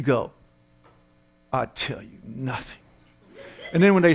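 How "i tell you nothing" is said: low, very soft, stoic refusal; defiant prisoner. Acted. Captured soldier answers enemy interrogator, low hushed defiance. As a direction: play the tight-lipped captive, quietly.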